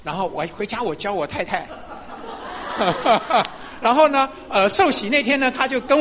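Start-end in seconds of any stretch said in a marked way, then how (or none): 1.7-3.8 airy laugh. Real, not acted. Laughs about teaching wife.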